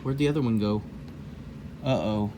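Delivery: usual speaking voice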